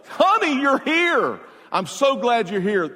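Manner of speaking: high, loud sing-song welcome for the wife; husband hamming up delight at the gate; mock cheerful husband. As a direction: gush over her arrival with a sly grin.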